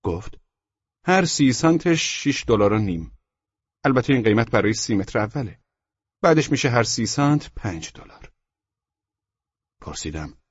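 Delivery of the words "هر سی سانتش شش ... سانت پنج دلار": higher, louder shop seller; emphatic, pitched-up salesman; salesman character. Acted. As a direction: rattle off the sales terms in a raised voice. Seller gives prices.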